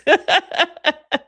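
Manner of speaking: high, bursting cackle; loud high laugh. Real, not acted. Laughing at the annoying voice.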